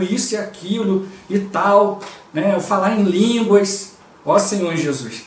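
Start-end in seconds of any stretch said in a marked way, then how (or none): none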